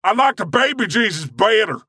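high Southern praise shout; Southern preacher voice. Retold or imitated. Answering the compliment with a high Southern thank you. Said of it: "great Southern voice".

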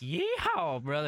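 mock Southern whoop. Playing along with Southern accent bit.

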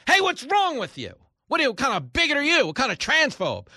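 hammed-up indignant accuser piling on; mock outrage. Host imitating accusers shouting bigot, in hammed-up outrage.

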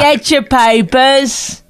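high, slow drawn-out street cry; newspaper seller. Pretend. Co-host shows partner how papers were sold, mock newspaper seller. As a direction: sing out a hammed-up newsboy call. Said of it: "Is that how they did it?".

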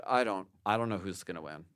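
ordinary delivery

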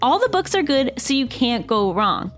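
upbeat sponsor read, clear and even. Acted. Host reads a book club ad to listeners, upbeat ad read. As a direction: sell the book club like a friend.

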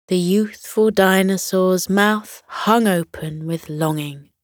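usual speaking voice

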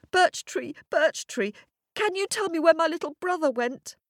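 put-on high, urgent child voice; little girl calling. A narrator's reading. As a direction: call out and ask in a high, hurried voice. Girl calls to magic tree.

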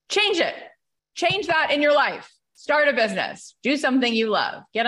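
high, loud call to action; preacher-style exhortation; rallying cry. Real, not acted. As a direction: fire up the crowd. Urging audience to change.

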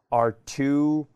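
ordinary delivery

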